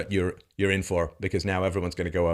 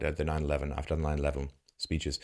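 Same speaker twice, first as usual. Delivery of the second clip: usual speaking voice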